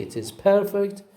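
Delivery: "perfect" stressed on PER; first-syllable stress. Shown as a demonstration. Teacher repeats the example for students, stressing PER in "perfect". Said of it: "put the stress on the first syllable".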